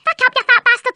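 usual speaking voice

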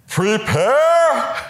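high, loud, drawn-out "Prepare!"; booming call. Retold or imitated. Priest stopping mid-homily to call out, booming. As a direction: ring it out to the pews. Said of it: "he would just stop and go".

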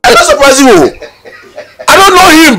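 very high, loud, laughing exclamation; squealing, amused protest; laughing denial. Real, not acted. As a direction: shout the denial through a laugh. Laughing denial of knowing him.

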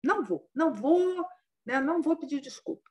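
high, heated refusal, digging in; an angry ascetic who won't say sorry; stubborn angry refusal. Retold or imitated. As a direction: refuse to apologise, half-hearted mock fury. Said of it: "muito bravo".

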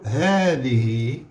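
هذه stretched out slowly; drawn-out هذه for dictation. Shown as a demonstration. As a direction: spell it out slowly for pupils. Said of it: "شنو نقولوا؟".

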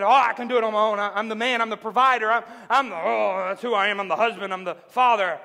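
loud, puffed-up bragging, imitated; boastful self-mockery. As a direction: play a mock-proud man listing his titles. Mocks own proud mindset, loud.

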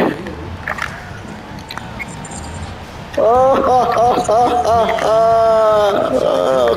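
long wheezing laugh. Real, not acted. Gamer gloating over his rival's loss, breathless laugh. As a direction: laugh until the breath runs out.

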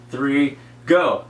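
usual speaking voice

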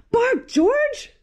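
high loud bark George; mother showing off her pup; proud mother call. Acted. Mother dog proudly calling to George, high call.

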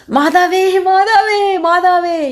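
high, loud cracking cry to the Mother; broken shriek. A narrator's reading. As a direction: scream it while being beaten. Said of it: "உடைந்த குரலில் வீறிட்டு கத்தினார்".

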